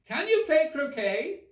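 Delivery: high haughty queen voice; the queen complains grandly; imperious queen. A narrator's reading. Queen scolds gardeners, high haughty voice.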